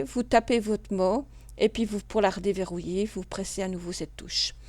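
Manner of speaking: ordinary delivery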